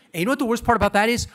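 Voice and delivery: fast, loud, high outburst; fast comic outburst; emphatic rant. Real, not acted.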